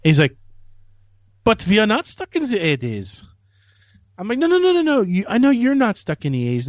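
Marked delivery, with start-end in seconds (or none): 1.4-3.2 high, indignant mock German accent; comic German accent, pitched up. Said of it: "my only German accent I can do". 4.4-6.7 high-pitched, hurried placating; rushing to smooth things over, pitched up; hasty reassurance. Retold or imitated. Hastily placates an offended guest.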